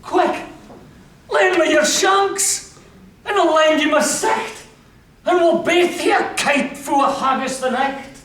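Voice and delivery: high, quick, laughing proposal; cheery wounded soldier. Acted. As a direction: pitch the plan brightly to his mate. McPhee's cheery plan voiced.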